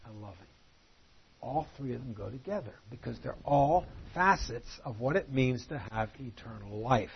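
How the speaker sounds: ordinary talk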